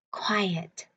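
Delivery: a hushed Quiet, as if in a library; whispered. Shown as a demonstration. Teacher whispering Quiet to children to show its meaning. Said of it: "Các bạn có biết quiet nghĩa là gì".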